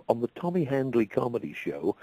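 usual speaking voice

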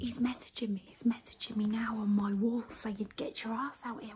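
hushed unvoiced whisper. Real, not acted. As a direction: whisper so the hall can't hear. Whispering backstage during bingo.

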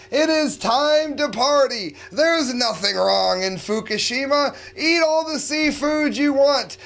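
high, loud mock-cheerful host; fake party cheer. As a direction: sell it like a carefree party host. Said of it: "a silly way to bring off a serious topic".

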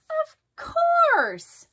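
squeaky and sure of himself; high Froggy voice. A narrator's reading. Froggy answering his mother, high and squeaky. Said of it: "said Froggy".

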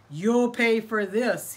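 slow, loud threat; menacing threat. Acted. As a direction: warn him, slow and loud. Angry farmer threatens coyote.

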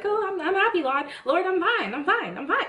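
mock-cheerful, high-pitched denial; fake happy. Bandaged-up believer insisting to the Lord, fake happy. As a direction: put on a brittle show of being fine. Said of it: "just trying to be like".

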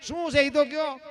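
a guest's high, anxious plea. Retold or imitated. Nervous guest asks the wife.

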